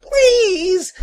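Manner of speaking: very high, loud plea; panicked high-pitched cry; terrified cry. Acted. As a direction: beg for mercy in a high wail. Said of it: "he cried".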